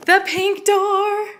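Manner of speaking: high, slow and loud; gushing makeover reveal. Real, not acted. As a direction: draw it out with delight. Tour reveal of door.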